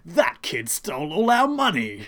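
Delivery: loud, slow, hammed-up English accent; failed English accent. Host voicing Speedwagon's complaint, badly faked English accent. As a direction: bellow it in a terrible mock English accent. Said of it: "not even an attempt at an English accent anymore".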